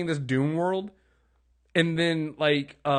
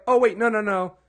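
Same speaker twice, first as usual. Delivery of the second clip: mock realization; 6 semitones higher, 5 dB louder than usual. Retold viewer's sudden realization.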